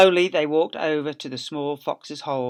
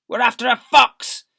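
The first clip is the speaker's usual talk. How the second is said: loud, higher voice; shouting farmer; 9 semitones higher, 11 dB louder than usual. Acted. Boggis shouting back at the jeering villagers. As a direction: bark it back up.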